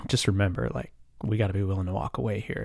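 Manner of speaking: low confidential aside; quiet aside. Retold or imitated. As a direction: pull him aside and murmur it. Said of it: "sidebarred him".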